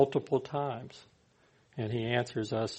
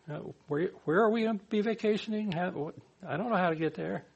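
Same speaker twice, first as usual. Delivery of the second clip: confused asking; 6 semitones higher, faster than usual. Retold or imitated. Confused traveller acted out.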